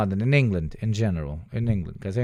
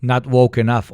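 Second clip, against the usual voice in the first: shouted out loud at the screen; loud joking shout; 3 semitones higher, 10 dB louder than usual. Real, not acted. Streamer shouting a quip at the video, loud. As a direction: blurt it loudly as a joke.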